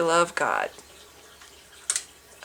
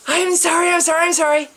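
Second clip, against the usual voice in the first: mock repenting; 9 semitones higher, 6 dB louder than usual. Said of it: "get on my knees".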